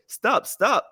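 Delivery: put-on high Indian-accented call to stop; imitated Indian accent. Indian man running for the elevator, high imitated Indian accent. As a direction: mimic an Indian man calling out urgently. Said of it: "Or he says in his way".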